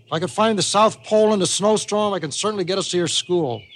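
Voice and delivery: high, animated grandpa voice; confident grandpa boasting. Acted. Grandpa assuring Molly he can find school, boastful. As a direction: boast with a lilting old storyteller's certainty.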